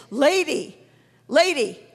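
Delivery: lightly voiced pastor's call; single out a person with a short falling call; calling someone out. Pastor calls to a lady at the back, mildly imitated calling.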